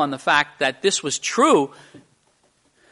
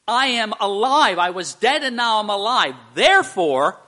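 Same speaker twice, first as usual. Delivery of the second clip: higher and louder proclamation of being alive; triumphant Jesus; 6 semitones higher, 6 dB louder than usual. Retold or imitated. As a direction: declare the resurrection in triumph. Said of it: "he's saying".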